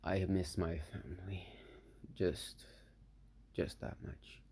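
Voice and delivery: hushed, low longing; voiced thought. A narrator's reading. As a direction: sink almost to a whisper, with pauses. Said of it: "it was just a thought".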